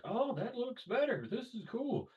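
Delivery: clueless coworker. Retold or imitated. A coworker admiring a botched car, high and clueless. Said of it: "one of them was like".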